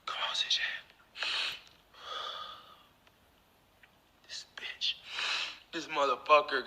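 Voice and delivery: seething whisper. Real, not acted. To viewers, still stewing over the stranger, seething whisper. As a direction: mutter it to yourself, still stewing.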